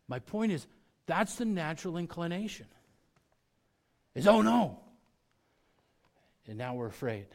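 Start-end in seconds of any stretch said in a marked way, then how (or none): 4.3-4.8 dismayed gasp. Acted. Acting our natural reaction to bad news.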